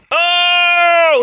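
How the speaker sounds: loud, high, long "oh"; drawn-out cry of shock; dismayed howl. Real, not acted. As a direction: wail out as the hit lands. His wrestler takes a beating.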